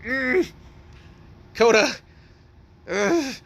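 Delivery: high, strained growl of frustration; acted exasperation about to blow; barely contained anger. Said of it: "He wants to, like, really hit him".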